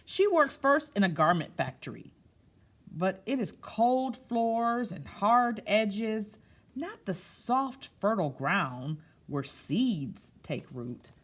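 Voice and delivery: slow picture-book narration; storytime reading. A narrator's reading. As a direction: lean into the hard, cold images for kids. Reads picture book aloud.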